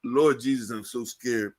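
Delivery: ordinary delivery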